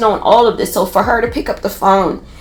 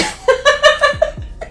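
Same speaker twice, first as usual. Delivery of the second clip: high laugh; 3 dB quieter than usual. Real, not acted. Laughs at the advice.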